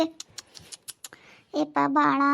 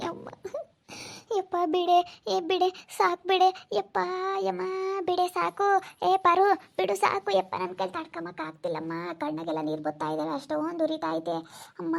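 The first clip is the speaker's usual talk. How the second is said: high begging, sinking softer into a whimper; pained pleading; 4 semitones higher, 4 dB quieter than usual. Acted. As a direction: beg them to stop, stung with pain. Acted begging to stop stinging treatment. (ನೋವಿನಿಂದ ಬೇಡಿಕೊಳ್ಳುತ್ತಾ)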